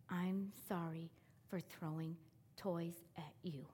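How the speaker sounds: put-on boy whispering sorry to the teacher; whispered apology. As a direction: breathe it out timidly.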